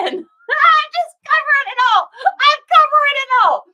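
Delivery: high, loud words through real laughter; laughing outburst. Real, not acted. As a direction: crack up at how much she crammed in. Laughing at her old description.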